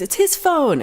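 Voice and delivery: high, pushy accusation; her accusing cry. Retold or imitated. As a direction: squeal the accusation, pointing at the empty chair. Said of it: "she goes".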